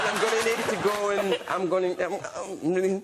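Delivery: high, loud fake crying, laughing through it; hammed-up fake crying with a break in the voice; mock sobbing plea. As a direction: ham up a teary excuse, voice up high and breaking with laughter. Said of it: "I made myself cry".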